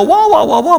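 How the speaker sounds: high-pitched wah-wah noise; imitated scolding babble. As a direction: mimic her ranting on down the line. Mimics the scolding on the phone.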